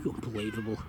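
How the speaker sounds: low muttered disbelief; exasperated. Real, not acted. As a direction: grumble it in disbelief. Cat interrupting.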